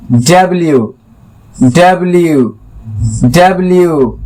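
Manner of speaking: डब्ल्यू as "dub-li-yu" with the y glide; डब्ल्यू said fully, not डब्लू; correct W. Shown as a demonstration. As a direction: give डब्ल्यू its full "dabliyu". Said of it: "डब्लू नहीं, डब्ल्यू".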